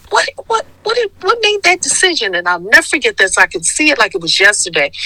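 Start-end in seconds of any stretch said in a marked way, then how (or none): 0.1-2.3 much higher, fast, wondering question; asking the friend in a high, amazed voice; high incredulous question. Retold or imitated. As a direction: squeak out the question in disbelief. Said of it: "I said".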